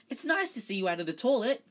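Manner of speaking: high, loud put-on friendliness; falsely bright. A narrator's reading. Hermione making nice to Myrtle, falsely bright. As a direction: gush with forced cheer. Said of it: "in a falsely bright voice".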